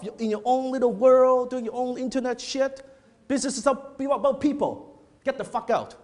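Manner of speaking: high-pitched, scolding tirade; yelling rebuke. Retold or imitated. Seminar leader berating a young attendee before the group, angry yelling. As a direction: tear into someone as an irate mentor would. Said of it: "He was yelling at me".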